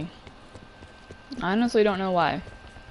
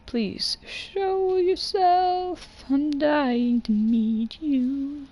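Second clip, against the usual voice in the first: slow, high, drawn-out plea; dramatic plea; 6 semitones higher, much slower than usual. Acted. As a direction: stretch it like a theatrical summons. Calling out to chat viewers.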